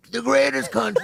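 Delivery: throaty Trump try; a hint of Trump rally voice; Trump impression.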